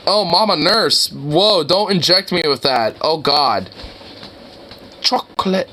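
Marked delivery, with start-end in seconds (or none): none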